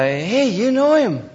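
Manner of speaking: high, loud, drawn-out query; imitated eager exclamation; eager call-out. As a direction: call out as if delighted by a shared friend. Retold eager reply to the yeller.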